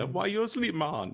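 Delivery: high-pitched Jamaican voice; Jamaican accent. Retold or imitated. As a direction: imitate the Jamaican guy from the special. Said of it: "The Jamaican dude".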